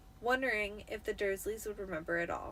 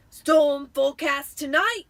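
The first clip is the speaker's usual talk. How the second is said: loud, slow, higher gleeful voice; gleeful Uncle Vernon; 5 semitones higher, 13 dB louder than usual. A narrator's reading. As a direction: relish the bad news. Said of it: "said Uncle Vernon gleefully".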